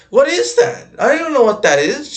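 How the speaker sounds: high, loud bewilderment; loud, high-pitched real confusion; baffled outburst. Real, not acted. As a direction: blurt it out, totally confused, pitch jumping up. Baffled by an unknown word.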